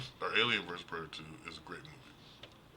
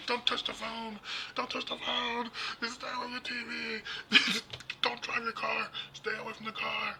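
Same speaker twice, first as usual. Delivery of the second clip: frantic horror-movie prophet no one believes; panicked warning; 5 dB louder than usual. Retold or imitated. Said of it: "some guy comes out and goes".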